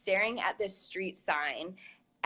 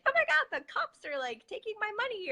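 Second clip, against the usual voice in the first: panicked squeal; 14 semitones higher than usual. Pretend. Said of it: "waiting for her to be like".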